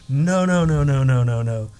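loud, insistent chorus of no; emphatic refusal. Retold or imitated. Friends refusing a friend's blindness, loud emphatic no. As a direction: shout down the bad news, firm and loud.

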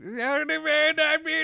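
very high, garbled cartoon babble; Scourge voice. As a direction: squawk out nonsense in a silly high voice. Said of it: "The Scourge is back".

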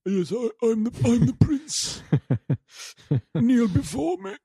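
high, slow pompous prince voice; slow theatrical villain menace. Retold or imitated. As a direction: lord it over them, drawn out and lofty.

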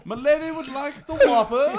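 high, loud, laughing courtly voice; medieval courtier. Acted. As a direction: order like a pompous knight. Mock medieval order at Burger King.

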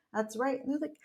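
ordinary delivery, nothing marked

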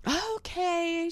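put-on high, drawn-out kid's reply; child answering back. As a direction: imitate a little girl's singsong reply. Child answers mother's yelled order.

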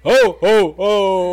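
loud laughing Santa ho ho ho; hammed-up Santa belly laugh; jolly ho ho ho. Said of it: "I'm Santa".

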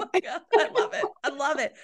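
high, quick laughing oh my God; delighted, laughing through it. Real, not acted. Laughing with delight at the textbook French line.

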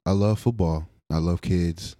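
usual speaking voice

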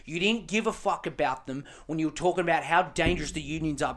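ordinary talk, nothing marked

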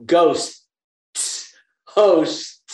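"ghosts" and "hosts" isolated, every final consonant sounded; crisp diction drill. Shown as a demonstration. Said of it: "I had to go".

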